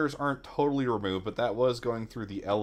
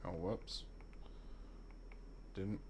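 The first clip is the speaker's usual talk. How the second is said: usual speaking voice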